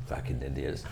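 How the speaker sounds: low, very quiet imitated grumble; mock-contemptuous aside, dropped right down; muttered aside. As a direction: mutter it under your breath. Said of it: "look to me and say".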